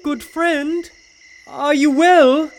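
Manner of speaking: loud call into the dark. Acted. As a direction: call down into the pit. Calling down into a dark pit.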